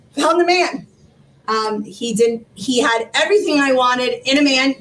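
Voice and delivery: ordinary delivery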